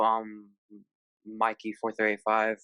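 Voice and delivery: ordinary talk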